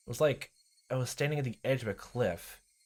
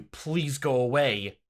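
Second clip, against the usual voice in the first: louder, slow desperate plea; pleading; 4 semitones higher, 6 dB louder, much slower than usual. Acted. Keiichi begging Rena to leave, loud and slow. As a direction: push her away with begging.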